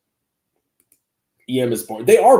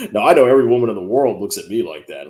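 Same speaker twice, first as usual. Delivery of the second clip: ordinary delivery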